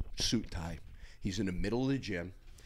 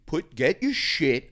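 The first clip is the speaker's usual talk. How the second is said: firm order; 6 semitones higher, 7 dB louder, much slower than usual. Retold or imitated. Ordering friend to leave.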